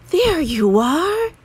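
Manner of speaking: relieved joy. Acted. Parents spotting Franklin over the knoll, relieved. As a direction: sound like parents finding their lost child.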